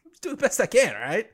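high-pitched plea to the bosses; playfully whiny self-defence; mock defensive protest. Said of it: "the council yelling at me".

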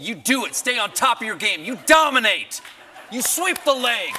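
loud high pep talk; hype man. Pretend. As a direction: shout yourself up before the show. Hyping himself up.

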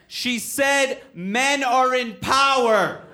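high, drawn-out call from the crowd; slow mock heckler's correction; imitated audience shout. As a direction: shout it out word by word. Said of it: "And then someone said".

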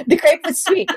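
laughing at her own mix-up. Real, not acted. Laughing mid-answer.

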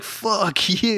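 high, drawn-out retold insult; mock rude retort. Noisy girls answering the film crew, mock rude retort.

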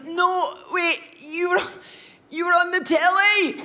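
high-pitched, insistent imitation; hammed-up calling to a celebrity mid-sickness; mock excited fan. Vomiting boy recognising someone off the telly, excited high voice.

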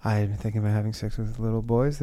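low, very soft admission; hushed confession. Acted. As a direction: murmur it like a secret confession. Acts penitent in confessional.

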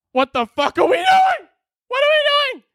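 very high, strained outraged shout; shrill-high disbelieving yell; voice shooting up. Real, not acted. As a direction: let the voice crack upward in outrage. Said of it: "My voice just had to go up eight octaves".

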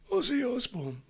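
put-on high-pitched Irish boy's voice; high Irish kid. A kid running up to him, high Irish-accented call. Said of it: "in an Irish accent, higher pitch".

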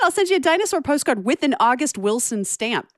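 high-pitched, thrilled offer; eager excitement. Real, not acted. Co-host offering to mail a dinosaur postcard, excited. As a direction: pipe up high, bubbling with eagerness.

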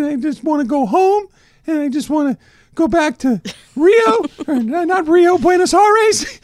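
put-on very high and loud, a wailing kid begging his mom; whiny crying child Messi. Messi wailing to his mom after missing a penalty, very high and loud. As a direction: whine it up high like a sobbing little boy. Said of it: "The best is the mom".

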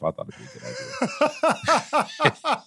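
high, slow, giggling retold reply; laughing through it. Retelling his cheeky reply, laughing.

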